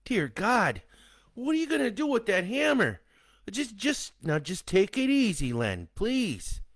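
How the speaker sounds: high, desperate begging; husband begging Len not to swing the hammer; frightened pleading. A narrator's reading. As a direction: plead with him to put the hammer down.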